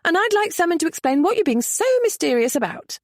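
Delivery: bursting out. A narrator's reading. Lady demanding an explanation, indignant outburst. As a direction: blurt it out indignantly. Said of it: "Merritt burst out".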